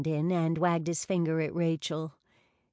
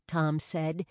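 usual speaking voice

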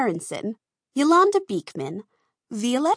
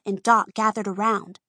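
usual speaking voice

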